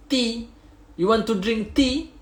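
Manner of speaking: ordinary delivery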